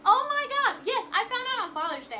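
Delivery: high, loud burst of excitement; sudden excited realisation. Real, not acted. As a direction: exclaim as it suddenly comes back to you. Suddenly remembers a detail to share.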